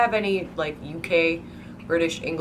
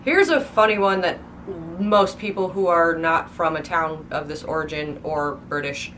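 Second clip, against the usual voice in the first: normal talking voice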